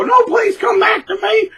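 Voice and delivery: high, loud wailing plea; mock begging. Mimicking the dumped partner begging to come back. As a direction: wail it like a desperate ex.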